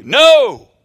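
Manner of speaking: emphatic no. Retold or imitated. Preacher voicing God's emphatic refusal, shouting. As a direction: hurl the word out like a divine command. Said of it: "No, God says emphatically".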